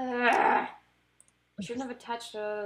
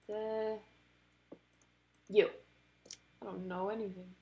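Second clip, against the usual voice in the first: ordinary talk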